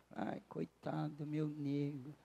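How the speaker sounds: put-on soft, slow pitying coo to a hurt child; retold tender parental soothing; soothing, consoling. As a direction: comfort a crying little one softly. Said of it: "ajudei ele a chorar".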